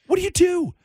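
exasperated, high rhetorical question; high-pitched exasperation. Real, not acted. Rhetorical question repeated for emphasis.

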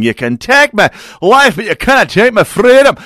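a rousing Braveheart-style cry in a bad Scottish accent. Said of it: "that was an atrocious Scottish accent".

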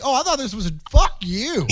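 sudden high angry outburst; mad. Retold or imitated. Comic telling off a man who yelled at him, mock angry. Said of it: "and then I got mad".

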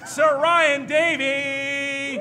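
booming slow announcer build-up; hype announcer intro. Acted. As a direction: roll out the names like a ring announcer. Hypes the show's cast intro.

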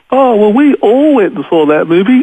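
high, excited imitation of delighted fans; gushing fan. Teller mimics captors who loved the Passion movie, gushing.

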